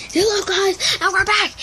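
high, bouncy host greeting; mock video intro. Boy opening a pretend video for viewers, high host voice. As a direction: sing out a host's hello.

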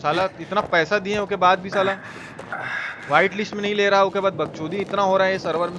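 ordinary delivery

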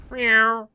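high playful cat meow; mock meow. Streamer voicing the cat in quest dialogue, playful high meow.

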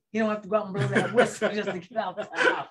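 quick words bubbling with laughter; laughing tease. Real, not acted. Host ribbing referee about his wife, laughing. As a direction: tease him through a laugh.

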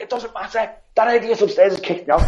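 high voiced woman, mildly annoyed; lightly voiced upset woman; knocked-over woman blaming her husband; woman's retort. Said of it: "she's like".

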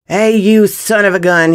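high, loud challenge to an intruder; angry shout. Acted. Gunman catching a thief at his saddlebags, shouting. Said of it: "he shouted".

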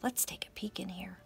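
hushed storytime whisper; a soft whisper to draw the kids in. Acted. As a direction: whisper as if peeking into a secret. Storytime secret peek whisper.

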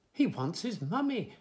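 mild shout. A narrator's reading. Old uncle calling out for the baby, mildly shouting. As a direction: call it out with mild urgency. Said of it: "shouted the old uncle".

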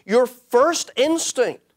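ordinary delivery

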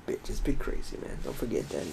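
breathy unvoiced whisper. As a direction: hush it like a secret. Repeats the catchphrase in a hushed voice.

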